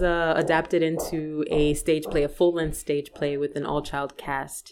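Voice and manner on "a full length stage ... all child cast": normal talking voice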